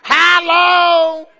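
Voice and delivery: high, loud drawn-out Hollow; playful imitation of a loud cry; imitating a holler. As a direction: holler it like a church lady. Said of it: "Ain't that the way we talk down here?".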